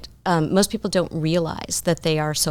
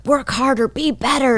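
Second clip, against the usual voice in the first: harsh self-talk; 8 semitones higher than usual. Retold or imitated. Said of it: "if I'm like".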